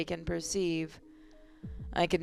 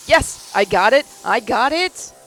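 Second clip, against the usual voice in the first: higher, louder relieved shout; relieved cheer; 3 semitones higher, 7 dB louder than usual. Acted. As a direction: cry out in relief. Relief after saving laptop.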